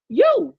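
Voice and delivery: YOU punched high and loud; single out the listener with a high, loud "You"; pointed retort. Retold or imitated. Retold pointed answer to puzzled crowd.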